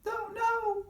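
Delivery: put-on high old-lady squeak; high granny voice.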